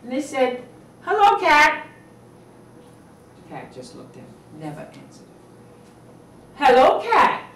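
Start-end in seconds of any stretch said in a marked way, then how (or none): 1.0-1.9 put-on high, loud child voice calling hello; bright kid calling to a cat; child greeting. 6.6-7.4 put-on high, loud child voice calling hello; bright kid calling to a cat; child greeting.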